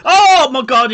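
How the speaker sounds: loud, high-pitched outburst at a goal; shocked shout. Real, not acted. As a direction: cry out in real disbelief as the goal goes in. Goal goes in on live watch-along.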